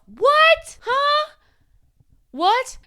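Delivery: sudden squeaky high what, huh; baffled disbelief. Pretend. To the viewers, mock shock at her brain's dark advice. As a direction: react as if you can't believe your own brain.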